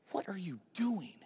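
soft, disbelieving reproach; hushed rebuke. Acted. Peter pulls Jesus aside to scold him, hushed rebuke. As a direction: take him aside and scold under your breath.

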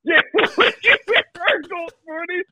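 high, laughing echo of the joke; giggly mock question. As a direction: crack up while repeating the offer. Cracking up, echoing friend's joke.

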